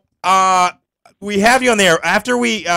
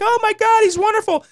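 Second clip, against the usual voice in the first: much higher mock swoon of giddy girls; higher, hammed-up fangirl gushing over the comic. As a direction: gush like a smitten fan, pitched way up. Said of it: "The girls were like".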